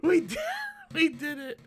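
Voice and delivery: high, mocking cheer collapsing into laughter; strangled-high impression broken by real laughing; imitated celebrating guy. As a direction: mimic the overexcited guy until you crack up. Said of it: "but he's like".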